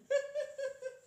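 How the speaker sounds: high, loud hammed-up cackle of an old woman; the old lady cracking up at the boy's accent, imitated cackling laugh. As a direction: burst into a big mock laugh as the old lady.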